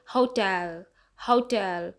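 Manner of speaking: हाउ टेल with a long, weighted टेल; हाउ टेल, stress falling on टेल; stress on second syllable. Shown as a demonstration. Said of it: "टेल पे stress दिया है".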